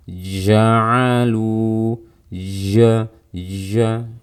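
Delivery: "Ja'alu, ja" with the j hissing on before the vowel; flowing jim error. Shown as a demonstration. Teacher asking students to listen closely, flowing jim in "Ja'alu, ja". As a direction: leak sound through a loose j in "ja". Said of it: "ada suara jim yang mengalir terlebih dahulu".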